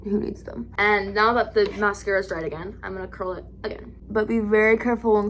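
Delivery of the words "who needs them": normal talking voice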